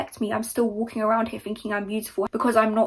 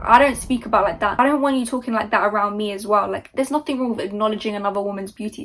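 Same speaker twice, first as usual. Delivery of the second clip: ordinary delivery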